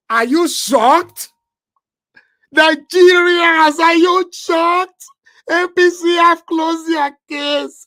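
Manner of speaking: high, slow, loud shouting at the audience; stunned and thrilled announcement; excited shout. Real, not acted. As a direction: call it out to the whole nation in disbelief. Announcing APC closed case, shouting.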